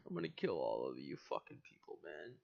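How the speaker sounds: low mock threat. Real, not acted.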